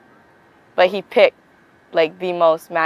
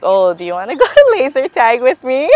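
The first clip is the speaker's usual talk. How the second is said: imitating him with a giggle, pitched up; laughing while quoting him; 7 semitones higher, 5 dB louder than usual. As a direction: replay his shy invite, laughing through it. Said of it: "He's like".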